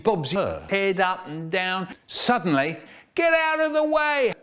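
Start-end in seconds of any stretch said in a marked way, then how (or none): 3.1-4.3 very high, shrieked order; yell. A narrator's reading. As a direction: yell to clear a path. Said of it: "Miss Goodall yelled".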